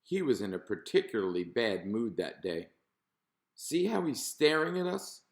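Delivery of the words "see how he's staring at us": a touch of irritation, pitched up; mildly annoyed fish, voiced lightly; irritable. A narrator's reading. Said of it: "he went on irritably".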